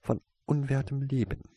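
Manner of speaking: high, contemptuous hiss; hissed contempt. A narrator's reading. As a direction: spit it out with disdain. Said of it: "zischte Misses Davian".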